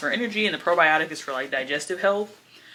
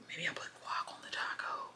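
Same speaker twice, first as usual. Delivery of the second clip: whispering; 18 dB quieter than usual. Real, not acted. Whispered aside.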